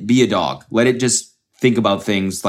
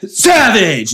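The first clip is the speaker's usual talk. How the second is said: loud, high yelled mock sneeze; hammed-up sneeze-yell; Logan Paul sneeze; 10 semitones higher, 5 dB louder than usual. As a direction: sneeze the word out as a shout. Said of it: "That's how Logan Paul sneezes".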